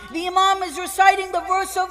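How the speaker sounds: ordinary delivery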